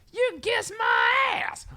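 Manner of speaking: high, drawn-out bratty yell; kid yelling. Retold or imitated. Film fan imitating the younger kid, high stretched-out yell. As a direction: screech it like a foul-mouthed little kid. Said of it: "just keeps yelling".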